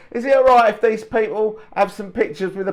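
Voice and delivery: high, hammed-up Cockney accent; mock Cockney accent. Imitating the American bandmate asking his band, in a put-on Cockney accent. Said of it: "He didn't say it in a Cockney accent".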